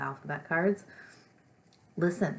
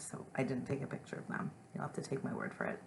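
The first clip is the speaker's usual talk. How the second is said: ordinary talk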